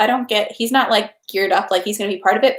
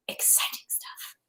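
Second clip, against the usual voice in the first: whisper; 17 dB quieter than usual. Real, not acted. Whispered aside after the cheer.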